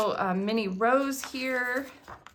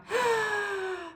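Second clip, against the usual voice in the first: high, sharp gasp of delight; excited gasp; 10 semitones higher, 4 dB quieter than usual. Real, not acted. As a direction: gasp like you can't contain yourself. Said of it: "Deep breath".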